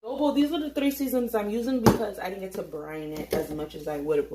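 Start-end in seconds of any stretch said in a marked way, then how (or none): none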